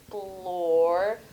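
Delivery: "blore" drawn out slowly as one syllable; isolated syllable. Shown as a demonstration. Teacher isolating second syllable for spellers, "blore" held long. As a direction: stretch the vowel of "blore" so it can be heard. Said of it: "So this syllable's".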